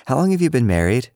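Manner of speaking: put-on high, eager little girl; chirpy kid asking a grown-up; girlish voice. A narrator's reading. Cicely asking Lily's mom, high and girlish.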